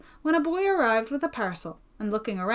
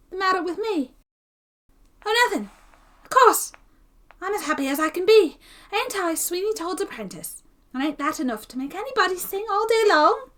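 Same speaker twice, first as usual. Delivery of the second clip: bitter mock cheer; 10 semitones higher, 3 dB louder than usual. A narrator's reading. Apprentice mocks his own happiness.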